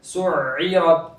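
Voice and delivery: slow सुइरत with the throat sound before इ held on; continuous ain. Shown as a demonstration. Teacher shows the continuing sound, slow सुइरत. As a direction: keep the sound flowing through सुइरत's doubled middle. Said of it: "आवाज जारी रहेगी".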